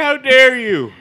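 loud, high indignant cry; mock outrage. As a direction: exclaim in hammed-up outrage. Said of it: "And I was like".

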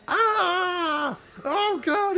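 high-pitched wail of pain; retold pained cry. Patient reacting to the toe injection, pained cry. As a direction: cry out as if the needle just went in. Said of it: "and I was like".

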